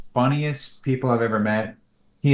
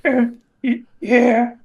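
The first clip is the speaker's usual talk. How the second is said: much higher, hesitant guilty little frog; sheepish Chubbum; 5 dB louder, slower than usual. Retold or imitated. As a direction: stammer out a guilty yes. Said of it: "He's like".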